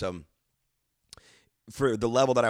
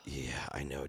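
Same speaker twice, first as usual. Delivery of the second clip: low mutter; 7 semitones lower, 10 dB quieter than usual. Retold or imitated. Retelling his own stage banter.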